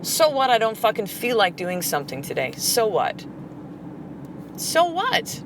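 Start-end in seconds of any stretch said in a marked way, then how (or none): 4.6-5.4 playful taunt. Real, not acted. Mock defiant sing-song.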